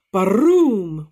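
loud rolling thunder boom. Acted. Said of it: "went the thunder".

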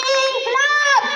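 long high drawn-out rally cry; slogan call. Acted.

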